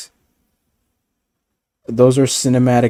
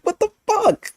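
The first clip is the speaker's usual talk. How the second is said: squeaky cry of disbelief, way higher; high squealed disbelief. Retold or imitated. Talking to the show, a high squealing what the fuck. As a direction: wail it in mock anguish. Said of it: "I'm like".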